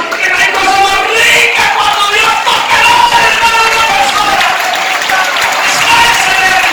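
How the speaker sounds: high shouted preaching over music; preaching at full force. Real, not acted. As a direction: cry it out to the congregation.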